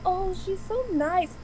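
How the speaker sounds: ordinary delivery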